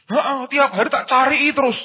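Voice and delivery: very high, loud retort; mock exasperated reply. Menirukan jawaban dalam percakapan keluarga. (menirukan jawaban ngotot bernada tinggi)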